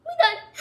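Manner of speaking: high loud villain cackle; evil laugh. Pretend.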